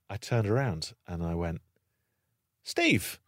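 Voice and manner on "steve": bright friendly call of his name; friendly greeting. Retold or imitated. In the pub, calling out to the troll, friendly. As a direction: greet him like an old friend. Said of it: "in a really sort of friendly way".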